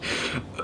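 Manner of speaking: deliberate throat-clearing cough; fake cough. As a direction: cough to cover a laugh. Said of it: "Fucking cough".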